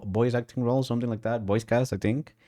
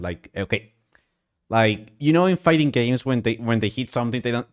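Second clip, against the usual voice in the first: usual speaking voice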